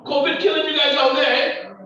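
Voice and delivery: loud, high shout of come home; preacher calling out to New York. Real, not acted. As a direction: call them home at full force.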